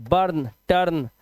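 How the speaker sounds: usual speaking voice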